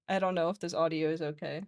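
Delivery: normal talking voice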